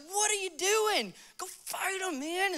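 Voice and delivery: high, hushed, incredulous mock David; indignant young David. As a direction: question them in a high, disbelieving voice. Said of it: "so David, he goes up to his brothers".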